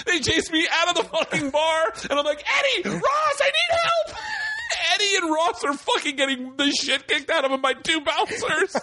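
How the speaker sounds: high-pitched hammed-up wail through laughter; mock panic. Chased out of the bar calling friends, high mock panic. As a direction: imitate a desperate cry for help. Calls beaten friends for help.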